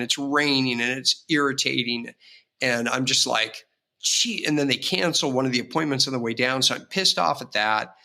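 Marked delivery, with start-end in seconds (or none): 4.0-4.4 sarcastic gee. Retold or imitated. Exasperated at rain and drive.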